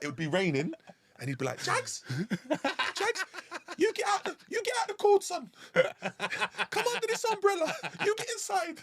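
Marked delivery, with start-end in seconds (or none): 1.6-8.8 very high mock-tender old manager calling him in from the rain; squeaky put-on voice of a fussing boss; hammed-up high coaxing voice; impression of the gaffer. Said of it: "He'd come in and be like".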